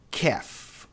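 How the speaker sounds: "calf" as kaf, no L; "calf" with no L, open a; silent L. Shown as a demonstration. Teacher shows the silent L in "calf". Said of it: "esse L não é pronunciado".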